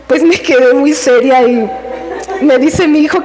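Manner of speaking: ordinary talk, nothing marked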